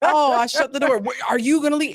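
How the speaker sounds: put-on ex-boyfriend panicking that she might leave; mock alarmed boyfriend. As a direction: act out the jumpy ex panicking. Imitating the boyfriend's fear over a door.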